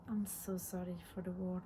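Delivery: ordinary talk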